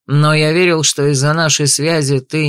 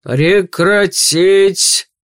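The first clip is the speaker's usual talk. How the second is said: raised, drawn-out order from the patriarch; thundering command; 5 semitones higher, 3 dB louder, much slower than usual. A narrator's reading. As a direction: boom it out, stretching every syllable. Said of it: "пророкотал голос отца семейства".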